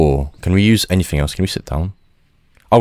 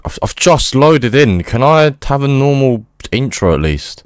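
exasperated; 9 semitones higher, 3 dB louder than usual. Real, not acted. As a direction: stress loaded in disbelief at the intro. Exasperated repeat at game intro.

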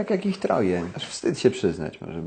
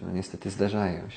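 normal talking voice

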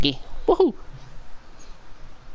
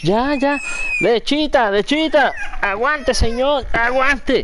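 arrullo a bebé; 10 semitones higher, slower than usual. Real, not acted. Soothing crying baby with milk. (voz aguda de hablarle a un bebé)

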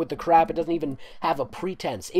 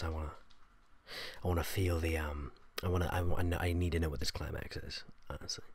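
ordinary talk, nothing marked

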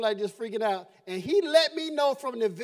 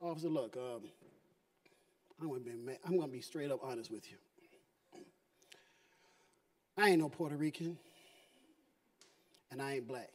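much softer, low confiding voice; hushed confession; 12 semitones lower, 8 dB quieter than usual. Retold or imitated. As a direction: confide it quietly to the cop. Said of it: "and I'm like".

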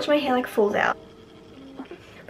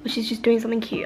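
ordinary delivery

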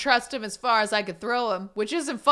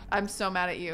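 ordinary delivery

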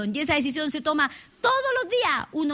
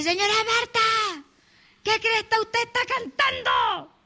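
put-on slow, higher, angry shout from an old man; furious old man; 5 semitones higher, slower than usual. Old man yelling at Marta for singing, furious. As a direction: bark it at her like an angry old neighbour.

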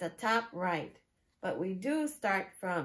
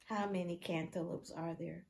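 usual speaking voice

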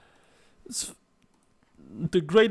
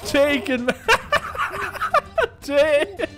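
laughing taunt; 5 semitones higher than usual. Pretend. Gamer mock-taunting while teabagging his opponent, laughing. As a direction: egg them on, cracking up.

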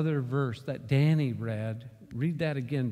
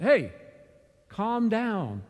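loud call; 8 semitones higher, 5 dB louder, much slower than usual. Retold or imitated. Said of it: "I said".

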